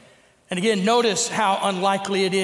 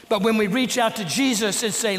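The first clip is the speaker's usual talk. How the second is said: usual speaking voice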